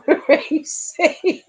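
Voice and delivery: high, slow, drawn-out call through giggles; gleeful name call. Real, not acted. Streamer calling out a friend who joined, high and drawn out. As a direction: stretch the name out as she laughs.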